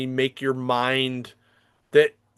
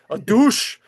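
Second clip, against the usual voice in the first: quick Scottish accent; 8 semitones higher, 5 dB louder than usual. Shown as a demonstration. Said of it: "In a Scottish accent".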